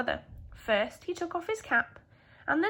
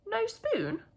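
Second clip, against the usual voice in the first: puzzled; 10 semitones higher than usual. Acted. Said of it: "he said".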